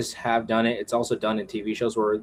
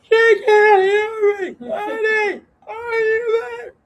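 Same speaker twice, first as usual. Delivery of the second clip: very high, drawn-out wailing call; mock calling out; 6 dB louder, slower than usual. Husband calling to wife who isn't listening, mock wailing call. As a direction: call out plaintively in a squeaky put-on voice.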